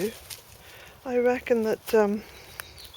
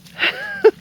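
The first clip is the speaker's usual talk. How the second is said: much higher burst of laughter; 9 dB louder than usual. Real, not acted. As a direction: laugh at the dog's mess. Dog in pond.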